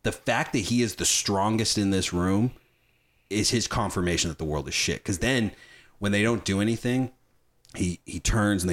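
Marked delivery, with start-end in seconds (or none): none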